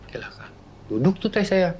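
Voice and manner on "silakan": a soft, breathy whisper of "silakan"; hushed eerie permission; whispered reply. Retold or imitated. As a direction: barely breathe the word, like a mysterious old man. Mysterious old man answers quietly.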